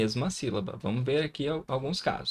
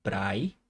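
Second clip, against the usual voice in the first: "prai" said alone as one syllable, both vowels sounded; isolated syllable; 4 semitones lower than usual. Shown as a demonstration. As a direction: model "prai" for learners, A gliding into I. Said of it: "você consegue notar aqui o som".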